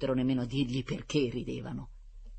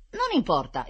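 louder, higher dismissal; exclaiming; 6 semitones higher, 6 dB louder than usual. A narrator's reading. Rita undeterred by the hidden clothes, exclaiming. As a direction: brush it off with an exclamation. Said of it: "esclamò Rita".

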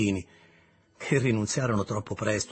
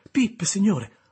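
put-on frightened child; 9 semitones higher, 7 dB louder, slower than usual. Scared child answering convict.

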